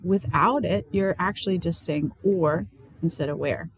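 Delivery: normal talking voice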